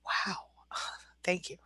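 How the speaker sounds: breathless whispered thanks, overwhelmed; a sigh, then barely voiced thanks, deeply moved; awed whisper. Real, not acted. Moved by a viewer's gift.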